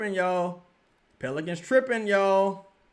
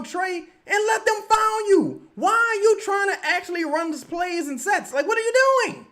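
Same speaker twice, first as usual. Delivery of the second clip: exasperated shout; 14 semitones higher, 6 dB louder than usual. Real, not acted. Streamer yelling at Trey on TV, high loud exasperation. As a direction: yell at the player like he can hear you.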